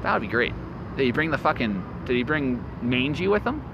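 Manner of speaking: ordinary talk, nothing marked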